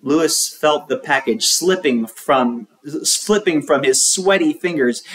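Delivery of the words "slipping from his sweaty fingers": ordinary delivery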